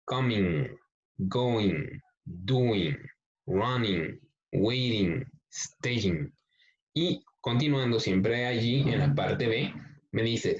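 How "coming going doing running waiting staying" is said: Coming, going, doing with a clear final ng; teacher repeating the ing words slowly; ing ending stressed. Shown as a demonstration. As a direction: end each word on a firm ng sound. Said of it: "como si fuera el sonido de la I con una J".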